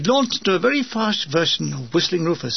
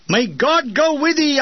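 dramatic farewell; 8 semitones higher, 3 dB louder, slower than usual. A narrator's reading. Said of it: "striking a dramatic pose".

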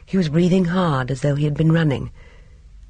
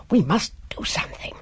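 whisper; 5 semitones higher than usual. Acted. Urgent aside during the scene.

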